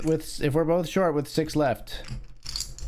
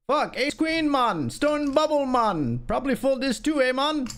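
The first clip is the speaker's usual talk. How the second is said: very high mock Jamaican accent; exaggerated Jamaican lilt; 12 semitones higher than usual. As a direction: ham up the Jamaican "man" on each phrase, pitched way up. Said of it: "Jamaican, man".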